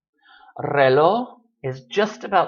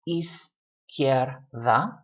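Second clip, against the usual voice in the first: "izquierda" in three slow beats, iz, quier, da; three separate syllables; much slower than usual. Shown as a demonstration. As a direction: pause between each syllable of izquierda. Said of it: "So you just have".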